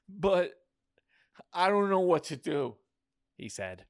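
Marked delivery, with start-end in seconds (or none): 0.1-2.8 higher, worried voice; high-pitched dismay; helpless protest. A narrator's reading. Helpless character protests to goddess.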